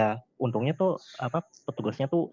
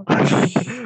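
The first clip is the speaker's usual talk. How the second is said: high laugh; 11 semitones higher, 6 dB louder than usual. Real, not acted. Laughing at own sleepy admission.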